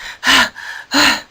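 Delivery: long breathless huff; exhausted panting sigh. A narrator's reading. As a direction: blow out air, worn out. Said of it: "she sounded like this".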